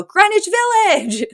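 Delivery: high, drawn-out joint cry; imitating two people exclaiming together; mock exclamation. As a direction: sing it out in a high, stretched voice. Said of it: "And then they both go".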